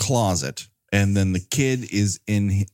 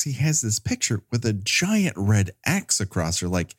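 normal talking voice

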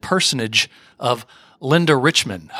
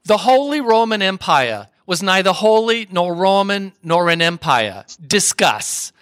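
mock thick New York accent, raised at the start; Linda Richman; 4 semitones higher than usual. TV host hands out a topic, in a mock New York accent. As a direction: imitate a New York talk show host. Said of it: "I'm gonna do my best, uh, Linda Richman, uh, impersonation".

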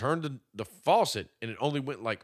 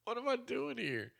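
mock despair; 11 semitones higher, 9 dB quieter than usual. Mock despair in cold bath.

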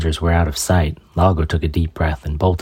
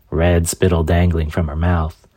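plain reading voice

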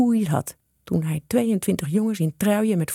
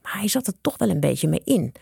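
ordinary delivery, nothing marked

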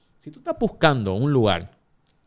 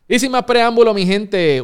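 big announcer build-up, much higher and louder; hype intro; 10 semitones higher, 7 dB louder than usual. Real, not acted. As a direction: call it out to pump up the crowd. Host hypes guest introduction.

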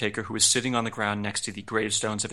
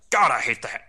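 imitated commentator's sudden yell; loud mock outburst, pitched up at the start; Jim Ross; 6 dB louder than usual. Host imitating a commentator yelling out at turnbuckle pyro, mock yell. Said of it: "Jim Ross just yells out".

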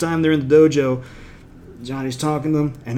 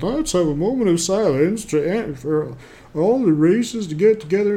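higher, pompous announcer voice; sanctimonious announcer; 6 semitones higher than usual. Retold or imitated. A sanctimonious speaker addresses the karate crowd, pompously. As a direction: play the preachy speaker, full of himself. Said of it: "you just want a sanctimonious douchebag".

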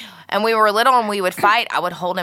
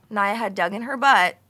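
ordinary delivery

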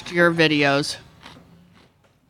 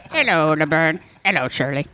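Squiggy; 3 semitones lower than usual. Acted. Host repeating his impression for co-hosts, low character greeting. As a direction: lower put-on sitcom sidekick voice. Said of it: "like, Squiggy and say".

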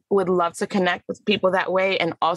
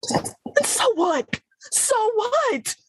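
very high, slow, bitter cry of "so what"; anguished rhetorical so what; much slower than usual. Acted. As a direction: fling the words up at Mama in disbelief. Monologue's bitter outburst.

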